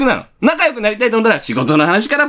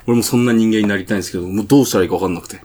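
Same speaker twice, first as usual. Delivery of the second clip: junior colleague; 12 semitones lower than usual. Acted. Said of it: "佐々木後輩".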